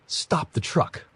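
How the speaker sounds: high-pitched shout at no extra volume; restrained narrator's shout. A narrator's reading. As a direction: cry out an urgent order, pitched well up. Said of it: "I shouted".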